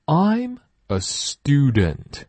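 very slow I'm a student, student with a plain stu; slow model sentence. Shown as a demonstration. Teacher modelling a sentence slowly. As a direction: spell it out slowly for copying. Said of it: "注意stu不是stew".

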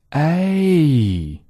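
A held long and pitched high, gliding a to e. Shown as a demonstration. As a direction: sing out a drawn-out A for students to copy. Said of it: "再来一遍。".

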